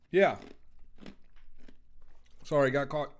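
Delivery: chewing ice while talking; mouth full. Real, not acted. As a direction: talk around a mouthful of ice. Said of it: "chewing on some ice".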